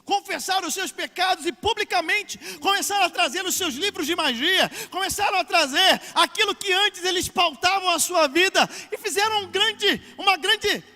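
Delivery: high, building to a loud peak; impassioned preaching. Acted. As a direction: preach it up to a ringing climax. Pregação crescente até o auge. (pregação inflamada, crescendo até o auge)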